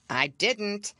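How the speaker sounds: ordinary delivery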